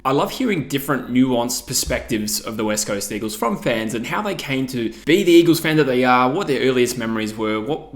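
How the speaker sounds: ordinary talk, nothing marked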